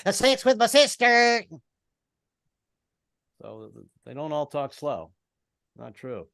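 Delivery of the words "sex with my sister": fast-talking Southern auctioneer. Acted. Comic imitating fast-talking Southerners, high auctioneer call. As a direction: pop one word out of the patter. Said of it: "they sound like an auctioneer".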